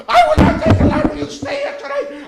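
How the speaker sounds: very high squeaky mock German voice; cartoonish high-pitched cave-in; squealed reply. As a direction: squeal it in a falsetto German accent. Frightened man's reply, acted out.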